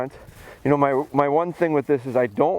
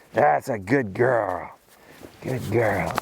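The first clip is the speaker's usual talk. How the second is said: slow praise; 2 semitones lower, much slower than usual. Real, not acted. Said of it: "you see how my voice and my excitement".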